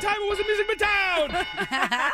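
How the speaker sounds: high and laughing; excited finish. Real, not acted. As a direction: gush it out with a laugh. Big laughing story finish.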